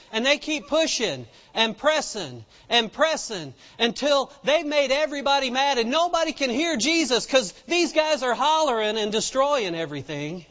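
ordinary delivery

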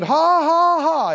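loud put-on "ha, ha, ha"; mock forced laugh. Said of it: "He kinda had to put it on".

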